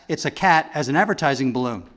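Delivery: rattled off fast; sped up. Shown as a demonstration. Comedian speeding up the cat bit, fast. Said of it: "if you speed it up real fast".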